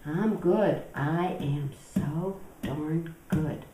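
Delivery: soft, slowing self-praise; self-satisfied good voice. Acted. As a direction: savor being so virtuous. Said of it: "my good voice".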